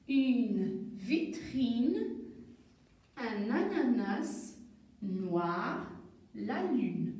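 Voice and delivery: each word drawn out slowly for the class; slow word reading. Shown as a demonstration. Teacher to pupils, slow model reading of key words. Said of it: "tous ces mots-là contiennent le son N".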